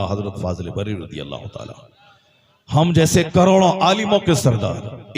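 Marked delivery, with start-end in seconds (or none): 2.7-5.1 "करोड़ों" driven hard, higher and louder; emphatic repeat. Acted. Preacher repeating the line louder, "करोड़ों" stressed. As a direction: hammer "करोड़ों" home to the crowd.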